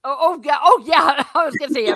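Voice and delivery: ordinary talk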